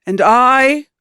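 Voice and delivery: slow, grand mid-Atlantic actor voice; transatlantic Shakespearean accent. Retold or imitated. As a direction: draw it out like a stage Shakespearean. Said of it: "weird transatlantic Shakespearean accent".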